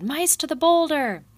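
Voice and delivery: clean high, loud rallying bellow ending on boulder. A narrator's reading. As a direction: call the retreat, boulder loud and falling. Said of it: "he bellowed".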